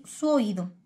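usual speaking voice

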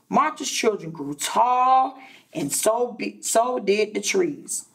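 ordinary delivery, nothing marked

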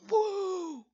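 loud, high excited whoop. Acted. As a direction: burst out with a thrilled whoa. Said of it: "he whooped".

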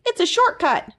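high, bright-eyed little critter voice; high eager sidekick calling a friend; Zeke the little creature. A narrator's reading. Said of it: "cried Zeke".

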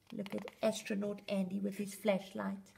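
usual speaking voice

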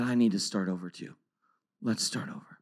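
low, hushed and soft; quiet retold apology to a child; tender reconciliation. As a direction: give in gently, almost whispering. Father tenderly reconciles with crying son.

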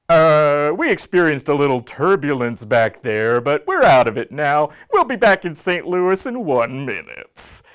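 airline captain over the intercom; pilot announcement. Acted. Pilot addressing passengers after turbulence, captain's announcement voice. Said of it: "the very next thing we hear is".

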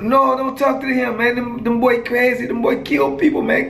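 put-on high-pitched Virgin Islands girl, warning her friend. Schoolgirl warning her friend off the dangerous boy, in a high voice. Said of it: "said the girl".

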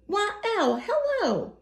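very high, chirpy greeting; a squeaky little mouse calling hello sweetly; tiny cheery mouse. Acted. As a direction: mouse sings out a bright falsetto hello. Mouse greets owl brightly.

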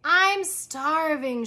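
slow drawn-out moan. A narrator's reading. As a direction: stretch it out, starving. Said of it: "she moaned".

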